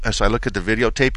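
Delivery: ordinary talk, nothing marked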